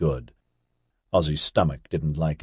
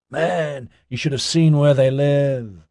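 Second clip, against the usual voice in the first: higher, drawn-out dude enthusiasm; enthusiastic dude; 7 semitones higher than usual. A narrator's reading. Ozzy raving about where they live, enthusiastic. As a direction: gush like an impressed hippie.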